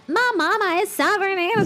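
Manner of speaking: slow, drawn-out Southern US twang; Southern US accent. Retold or imitated. Mocking the Tennessee brides on the show, slow Southern US accent. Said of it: "hablan todo el rato como".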